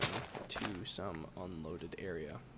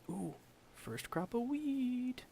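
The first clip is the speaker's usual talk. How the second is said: excited; 13 semitones higher, slower than usual. Real, not acted. Delighted at first wheat harvest.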